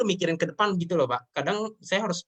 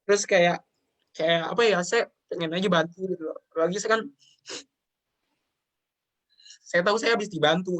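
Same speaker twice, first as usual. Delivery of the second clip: higher, emotional, with halting pauses; real tearful; 3 semitones higher than usual. Real, not acted. Guest explaining the wish to help the team, tearful. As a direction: plead earnestly while holding back tears. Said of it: "saya cengeng".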